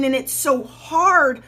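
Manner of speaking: ordinary delivery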